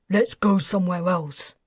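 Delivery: plain reading voice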